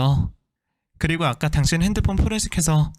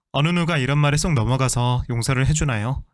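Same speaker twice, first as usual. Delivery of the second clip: plain reading voice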